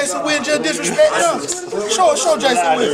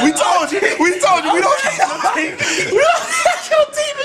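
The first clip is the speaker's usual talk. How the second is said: laughing, high-pitched taunt; gleeful taunting; 9 semitones higher than usual. Real, not acted. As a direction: crow over the prank. Taunting teammate after prank.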